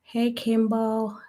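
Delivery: ordinary talk, nothing marked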